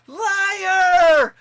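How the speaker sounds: huge high shout; playful mocking yell from the car window. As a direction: bellow it like a whole carload of friends. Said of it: "car just yells".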